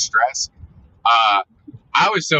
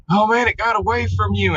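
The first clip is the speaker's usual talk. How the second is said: much higher, chirpy mock old man; imitating old man; 10 semitones higher than usual. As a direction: play the friendly old man lightly. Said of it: "he was just all like".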